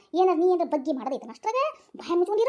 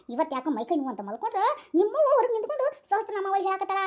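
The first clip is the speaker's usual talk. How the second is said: usual speaking voice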